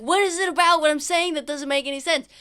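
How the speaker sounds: high, loud, insistent scolding; exasperated outburst. Acted. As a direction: drive it home as if shaking someone. Exasperated character shakes his pupil.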